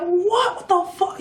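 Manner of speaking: very high, stunned what; shocked boyfriend. Retold or imitated. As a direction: react in total disbelief. Boyfriend hears the story.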